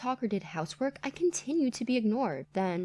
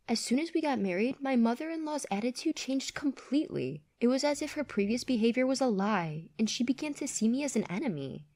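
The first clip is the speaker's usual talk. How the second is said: plain reading voice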